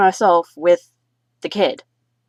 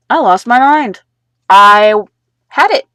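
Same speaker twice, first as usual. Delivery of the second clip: boiling over; 4 semitones higher, 7 dB louder than usual. Real, not acted. Recalling losing control.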